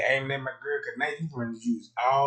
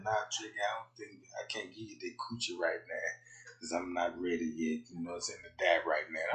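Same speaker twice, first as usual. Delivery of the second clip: lower, soft coy refusal; mocking side chick; 7 semitones lower, 7 dB quieter, faster than usual. Side chick softly refusing Trey Gang, a low coy voice. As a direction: hush it like a shy girl. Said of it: "she talking about".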